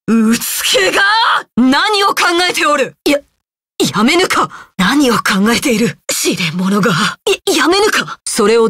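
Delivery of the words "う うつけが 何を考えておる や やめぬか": indignant scolding that jumps high; stammer, then cry out in outrage; flustered outrage. Acted. Warrior scolding a fool for his antics, flustered and indignant.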